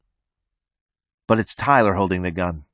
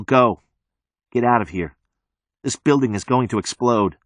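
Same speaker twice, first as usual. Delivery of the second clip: usual speaking voice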